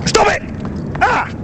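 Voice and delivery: excited yelp. Real, not acted. During his run-up bowl.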